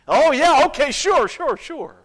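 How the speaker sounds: acted startled compliance; flustered agreement. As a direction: agree in a startled, high-pitched rush of assent. Acts out flustered parents' reply.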